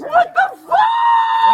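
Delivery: high, slow furious yell; furious shout. Real, not acted. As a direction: yell it out in rage. Shouts at officers restraining him.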